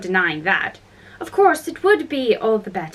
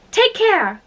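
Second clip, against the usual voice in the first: high, urgent warning; crying out; 9 semitones higher than usual. A narrator's reading. Alice warns the Queen about the brooch, crying out. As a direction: call it out sharply, pitched up. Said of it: "cried Alice".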